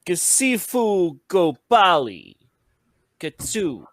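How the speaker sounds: slow, high, loud incantation. Pretend. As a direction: intone the spell slowly. Says the final spell words.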